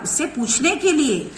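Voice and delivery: ordinary talk, nothing marked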